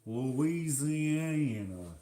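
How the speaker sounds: put-on very slow, stretched Louisiana accent; mock Louisiana drawl. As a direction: draw out every syllable in a put-on Louisiana twang. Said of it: "What is the Louisiana accent?".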